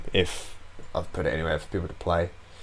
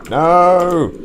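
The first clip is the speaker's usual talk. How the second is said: shout; 10 semitones higher, 11 dB louder than usual. Real, not acted. Losing in game, cries out.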